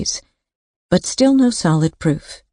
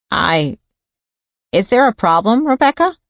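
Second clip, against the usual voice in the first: high, nasal, rising worried question; 6 semitones higher than usual. Acted. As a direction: lift it high through the nose. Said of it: "Bert Spiker's high nasal voice".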